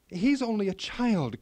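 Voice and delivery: put-on a mother begging for her child; pleading cry. A narrator's reading. Queen pleading with the elf for her son, crying out. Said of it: "cried the queen".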